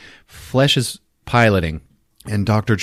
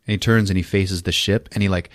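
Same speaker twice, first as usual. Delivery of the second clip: ordinary talk, nothing marked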